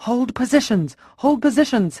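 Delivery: high, loud shouted command; screaming order. Acted. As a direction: scream at the men to hold. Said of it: "Houlihan screamed".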